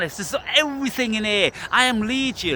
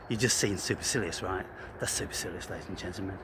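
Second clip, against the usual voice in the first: near-whispered, much softer; hushed aside; 11 semitones lower, 9 dB quieter, faster than usual. Real, not acted. Presenting his persona to viewers, hushed announcement. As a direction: announce it under your breath.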